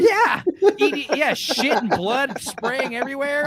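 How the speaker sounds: laughing through the gory details. Real, not acted. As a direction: laugh while describing the mess.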